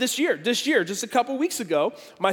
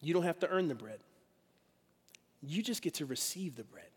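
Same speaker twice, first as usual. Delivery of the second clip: reassuring; 3 semitones lower, 11 dB quieter than usual. Retold or imitated. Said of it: "Jesus is saying".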